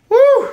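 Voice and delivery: sudden high loud whoop of joy. Real, not acted. Collector whoops at his short print, loud and high. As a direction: whoop like you won.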